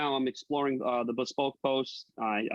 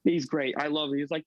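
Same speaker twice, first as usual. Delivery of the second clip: usual speaking voice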